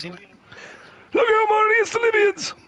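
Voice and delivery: high, slow, wild Doc Brown shriek; hammed-up Doc Brown cry; Doc Brown impression. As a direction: yell it like a mad scientist. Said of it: "you do do that very well".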